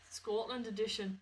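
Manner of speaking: slow, light Scottish accent. Shown as a demonstration. Co-host tries the Scottish accent when asked. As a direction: roll out the words in a Scottish accent. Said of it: "and you do the Scottish accent".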